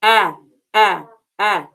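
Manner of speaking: a said as the short a of apple, three quick clipped repeats; short A sound. Shown as a demonstration. Teacher repeating the short a of apple for students, clipped. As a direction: model the short a again, a, a, a. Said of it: "Give me the short A sound".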